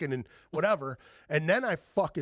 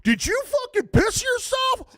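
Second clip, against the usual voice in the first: slow, high, loud mocking yell; teasing shout; 8 dB louder, slower than usual. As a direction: holler it across the street to tease him.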